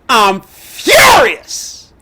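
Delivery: normal talking voice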